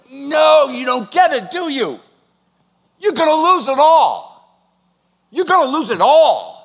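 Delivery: high, loud anguished plea; impassioned, high-pitched anguished warning. Acted. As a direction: cry out in anguish to people who won't understand. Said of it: "He's anguishing them".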